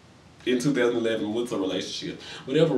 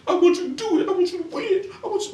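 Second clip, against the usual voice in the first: much higher, laughing fake sob; mock crying voice; faster than usual. Shown as a demonstration. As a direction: wail it out like a hammed-up crybaby. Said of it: "I gotta do it in my crime voice".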